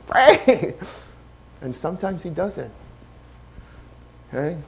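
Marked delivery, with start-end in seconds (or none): 0.1-0.7 imitated son. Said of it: "He's like".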